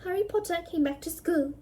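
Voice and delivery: usual speaking voice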